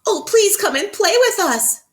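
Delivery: high, loud pleading monkey voice; begging monkeys. Acted. Monkeys begging the butterfly to play, high pleading. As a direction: beg eagerly like silly monkeys. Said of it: "begged the monkeys".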